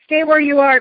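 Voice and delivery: acted urgent shout; Diggory's cry. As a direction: cry out a command to halt. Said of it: "cried Diggory".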